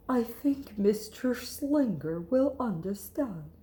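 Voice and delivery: slow, hushed whisper; gentle whispered reassurance; whispered mother. Acted. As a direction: soothe it in a whisper. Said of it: "said Lily's mother".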